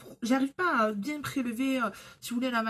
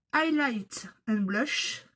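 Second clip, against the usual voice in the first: slow, put-on English accent; name read in a jokey English accent; deliberately bad English accent; fake English accent; much slower than usual. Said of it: "toujours avec mon accent anglais bidon".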